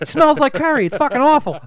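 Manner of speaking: high, loud mock panic; mock yell. Friend adds to the game, yelling high. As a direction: yell it in the girl's voice.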